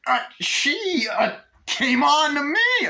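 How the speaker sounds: high, loud, slow stammered excuse; hammed-up high-pitched defence; imitated panicked excuse. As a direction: blurt it out as a caught-out Chucky. Chucky caught, makes loud excuse.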